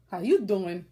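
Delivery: Brooklyn greeting. Retold or imitated. Repeating a yarn seller's greeting, in a Brooklyn accent. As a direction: toss out "How you doing?" the Brooklyn way, leaning on "you". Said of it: "'Cause I'm Brooklyn".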